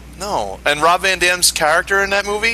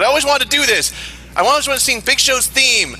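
quoting Maria Menounos; 9 semitones higher than usual. Retold or imitated. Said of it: "she said".

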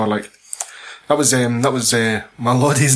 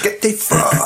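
imitating Luke, pitched up; mock Luke; 8 semitones higher, slower than usual. Friend imitating Luke telling guards off. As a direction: spit it out as tough Luke. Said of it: "He's like".

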